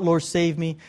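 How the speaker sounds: ordinary talk, nothing marked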